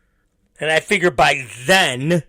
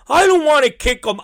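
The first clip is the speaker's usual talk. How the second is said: put-on high, fast whining little boy; whiny kid; 9 semitones higher, faster than usual. Son refusing his dad's advice, high fast whine.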